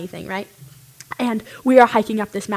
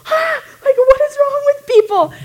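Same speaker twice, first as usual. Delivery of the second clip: very high, loud, exasperated shout; outraged cry; 8 dB louder than usual. Retold or imitated. Strangers drove off without helping, outraged shout. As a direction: wail at the unfairness of it. Strangers drive off refusing water.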